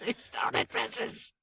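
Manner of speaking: unvoiced whispery creature rasp; creature impression. Host jokes about the stolen ring, whispered creature voice.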